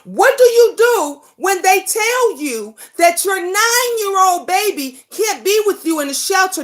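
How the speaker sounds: normal talking voice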